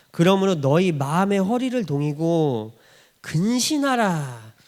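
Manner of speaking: slow, weighty reading of the verse; measured Bible recitation; solemn scripture reading. Acted. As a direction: read it slowly as holy text.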